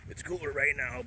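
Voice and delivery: lightly done wrestler voice; Hulk Hogan voice. Pretend. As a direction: growl it like a pro wrestler. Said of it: "This is my Hulk Hogan voice".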